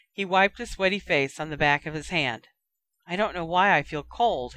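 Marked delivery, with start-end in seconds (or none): none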